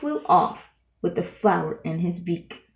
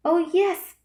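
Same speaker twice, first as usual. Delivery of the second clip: higher, piping little voice; piped; 9 semitones higher than usual. A narrator's reading. The tiny mayor piping up to Horton, high and eager. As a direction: pipe it up like a tiny Who. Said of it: "piped the voice".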